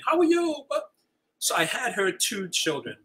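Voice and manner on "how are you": bright, much higher teacher's hello; teacher greeting. Retold or imitated. Former teacher greets a parent in a bright, high voice. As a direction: chirp it like a cheerful teacher. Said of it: "I did my teacher thing".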